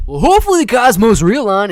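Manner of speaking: high, booming call-out to the viewers; loud hyped greeting. Real, not acted. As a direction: shout it out big to the whole stream. Belting out a stream greeting.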